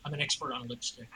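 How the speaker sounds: low, quiet, composed claim; very calm. Acted. Player answers the suspicious cop in character, very calmly. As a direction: say it softly and very calmly. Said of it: "say very calmly".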